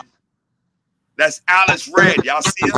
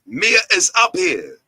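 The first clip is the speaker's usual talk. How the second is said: ordinary delivery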